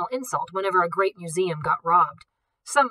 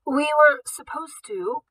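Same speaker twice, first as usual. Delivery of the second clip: coughing; 8 semitones higher, 4 dB louder, slower than usual. A narrator's reading. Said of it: "she coughed again".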